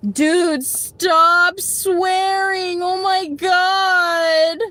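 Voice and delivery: high, very slow whiny mockery; high, drawn-out mocking whine; mocking whiny commenter. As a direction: drag it out to mock her. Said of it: "mocking the commenter again".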